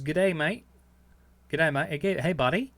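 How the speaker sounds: light Australian accent impression; mock Australian. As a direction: toss off a cheery Australian g'day. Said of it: "Chris Fox says".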